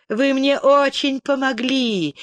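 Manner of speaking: mocking. A narrator's reading. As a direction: thank them with pointed sarcasm. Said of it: "издевательски сообщила леди Энн".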